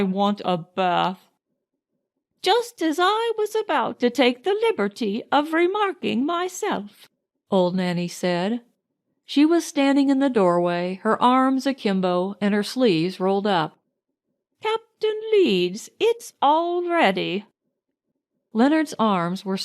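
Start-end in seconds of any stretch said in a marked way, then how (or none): 2.4-7.1 lightly voiced old nurse, higher; a hint of a fussy old Irish nanny; higher-pitched old nanny, prim and teasing. 14.6-17.5 put-on slow, much higher old nurse calling; drawn-out high old nanny voice. Nanny announces the bath.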